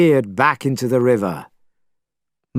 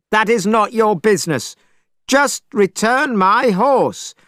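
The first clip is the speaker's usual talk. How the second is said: angry Monkey; 9 semitones higher than usual. A narrator's reading. Monkey confronting the dragon, high and insistent. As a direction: demand the horse back. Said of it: "Monkey confronts dragon".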